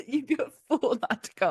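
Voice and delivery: Disney villain voice. Retold or imitated. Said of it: "Disney villain voice. In a British accent".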